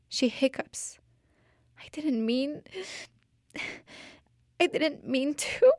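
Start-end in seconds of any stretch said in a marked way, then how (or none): 1.8-5.7 put-on a touch of a weepy child's plea; lightly voiced tearful girl; girl tearfully insists it was an accident; mild crying. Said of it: "She cries".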